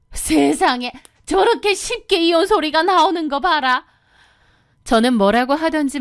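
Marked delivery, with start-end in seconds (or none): 0.1-3.8 jab a finger and rail at her; scolding mother-in-law. Acted. (삿대질하며 높이 호통치는 시어머니)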